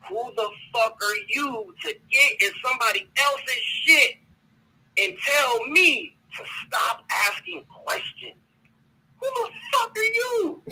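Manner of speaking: rising shout, slowing on the final question; heated rant. Real, not acted. Ranting at the woman who told him to stop asking, building to a high pained shout. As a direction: let the outrage break through on the last line.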